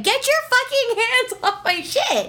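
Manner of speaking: high-pitched mock outrage; angry retort. Telling off the man who took her laundry, angry retort. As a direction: retell an indignant outburst, pitch way up.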